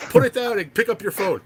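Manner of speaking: high, loud urgent order; excited command. Retold or imitated. Excited friend voiced on phone.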